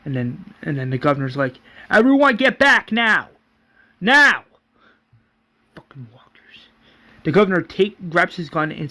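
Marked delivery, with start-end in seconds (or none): 1.9-4.5 high, loud shout; shouted command. Acted. Governor orders everyone back from walkers, shouting. 5.8-6.7 very soft, under the breath; whispered curse. Acted. Governor curses the walkers to himself, whispering.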